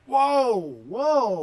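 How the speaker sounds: loud startled whoa; surprised shout. Real, not acted. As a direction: yell out in shock.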